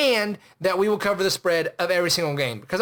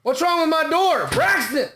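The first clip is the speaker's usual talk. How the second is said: much higher and louder, yelling after him; shouting after Braxton about the door; alarmed shout; 10 semitones higher, 3 dB louder than usual. Real, not acted. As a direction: call out in alarm as he leaves. Yelling after the leaving guest.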